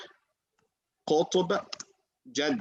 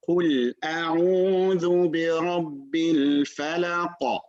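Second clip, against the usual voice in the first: Quran recitation; 2 semitones higher than usual. Shown as a demonstration. Teacher recites the verse.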